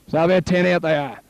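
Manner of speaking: normal talking voice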